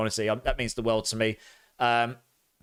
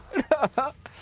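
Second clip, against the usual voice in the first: high laugh; 14 semitones higher than usual. Real, not acted. Gagging on a vomit-flavoured bean.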